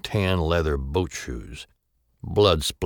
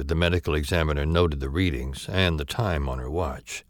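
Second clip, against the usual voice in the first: ordinary delivery, nothing marked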